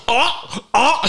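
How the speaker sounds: gasping YouTuber, high-pitched; clickbait video narrator. Pretend. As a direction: gasp like a hype YouTuber. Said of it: "I'm putting on my YouTuber voice".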